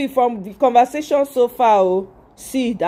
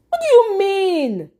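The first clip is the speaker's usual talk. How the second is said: incredulous outburst; 9 semitones higher than usual. Real, not acted. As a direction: demand an answer in exasperated disbelief.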